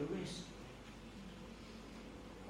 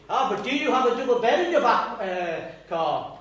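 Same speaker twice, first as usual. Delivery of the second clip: louder, higher cocky brag from a car window; boastful driver; 5 semitones higher, 8 dB louder than usual. Retold or imitated. Texan in the Beetle taunting the Rolls driver, mock boast. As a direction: toss the boast off and drive away. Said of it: "and the Volkswagen says".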